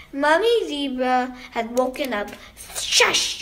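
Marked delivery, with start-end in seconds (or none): 2.8-3.4 sharp unvoiced shush; hushing shush. A narrator's reading. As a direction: shush noisy children. Mother hushes noisy girls.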